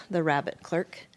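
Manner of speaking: ordinary delivery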